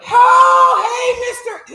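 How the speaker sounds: very high, loud startled squeak; startled employee. Acted. Employee startled by the boss, squeaking hello. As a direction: squeal a greeting, caught off guard.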